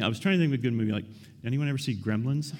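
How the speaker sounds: ordinary talk, nothing marked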